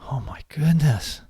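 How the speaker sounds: breathy whispered gasp of dismay; shocked whisper. Retold or imitated. Experimenter reacting to spilled slides, whispering. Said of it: "the experimenter then looks at you and says".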